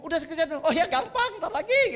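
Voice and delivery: high, hammed-up carefree putting-off; easygoing phlegmatic. Preacher mimics a phlegmatic husband answering his wife, mock carefree. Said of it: "plekmatis ya".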